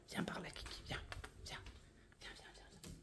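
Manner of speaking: soft whispered calls to the pet; coaxing whisper. Real, not acted. Calling Kiki over in a whisper. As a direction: whisper to coax the animal closer.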